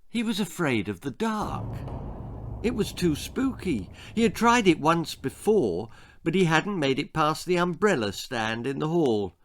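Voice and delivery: ordinary delivery, nothing marked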